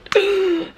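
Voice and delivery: sharp high gasp of shock; imitated gasp. Wife imitating her husband's sharp shocked gasp. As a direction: gasp the way he did on seeing his friend. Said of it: "my husband just went".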